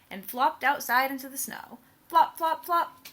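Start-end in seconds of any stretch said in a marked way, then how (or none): 2.1-3.1 loud, high flop flop flop; flopping sound words. Acted. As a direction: bounce out each flop. Sound words read aloud.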